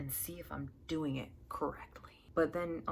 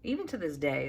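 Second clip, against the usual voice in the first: usual speaking voice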